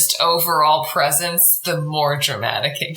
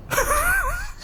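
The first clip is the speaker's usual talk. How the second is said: high laugh; 5 dB quieter than usual. Real, not acted. Laughs after whispered joke.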